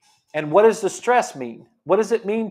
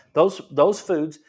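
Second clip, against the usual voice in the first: normal talking voice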